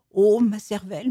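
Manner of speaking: normal talking voice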